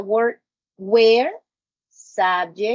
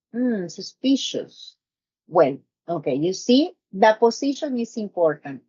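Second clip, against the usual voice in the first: normal talking voice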